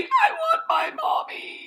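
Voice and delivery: high crying wail; mock wailing. Shark terrified of the spider, hammed-up crying wail. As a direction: wail for Mommy like a scared child.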